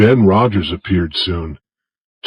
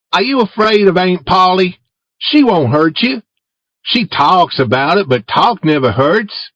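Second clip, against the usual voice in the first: put-on higher, boyish voice for Tom; Tom asking Jim; 9 semitones higher than usual. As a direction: coax Jim in a raised boy's voice.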